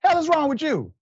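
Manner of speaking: venting in disbelief, voice shooting up; outraged. Real, not acted. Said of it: "I just needed to say that, get it off my chest".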